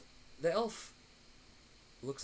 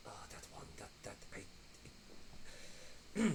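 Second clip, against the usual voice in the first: unvoiced, much softer, halting whisper; elf whispering aside; 4 semitones lower, 6 dB quieter, much slower than usual. Distracted elf whispers to the prisoner about her partner, halting whisper. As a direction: lean in and whisper nervously. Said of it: "she's just like".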